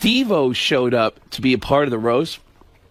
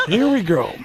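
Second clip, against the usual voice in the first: Pacino impression; 8 semitones higher, slower than usual. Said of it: "the Pacino looked and went".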